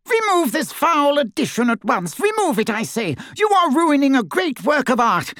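very high, imperious indignation; high-pitched pompous outrage; snide, outraged grandee. A narrator's reading. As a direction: command them in affronted hauteur. Snide portrait Phineas protests.

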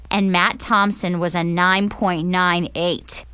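plain reading voice

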